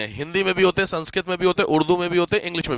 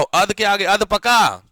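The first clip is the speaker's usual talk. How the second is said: अध के आगे अध पका drawn out word by word; slow emphatic; much slower than usual. Shown as a demonstration. Teacher models the example for confused students. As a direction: spell it out slowly, hitting अध. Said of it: "अध मैंने बोला तो अध होगा पूरा अध".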